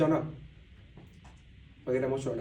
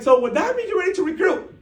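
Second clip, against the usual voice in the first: much higher playful coaxing voice; teasing mentor; 8 dB louder than usual. Retold or imitated. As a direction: nudge him with a sly grin. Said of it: "She's like".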